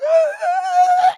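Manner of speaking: high, loud hammed-up sobs; imitating her own crying in front of the boss; mock sobbing. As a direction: wail it like a crybaby. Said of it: "started to cry".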